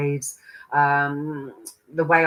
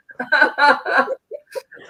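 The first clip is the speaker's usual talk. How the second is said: long, high, loud laugh; helpless laughter; 11 semitones higher, 7 dB louder than usual. Real, not acted. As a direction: collapse into laughter at your own muddle. Laughing at her own muddled words.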